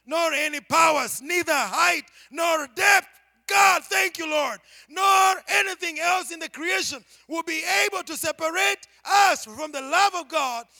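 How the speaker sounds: loud, high, ringing sermon climax; full-force preaching. Acted. Preacher thundering the scripture to the congregation, full force. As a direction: preach it out to the rafters.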